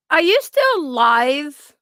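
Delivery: loud, slow, drawn-out call. Real, not acted. Streamer checking whether Maria is still live, calling out. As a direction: call across to someone, stretching the words.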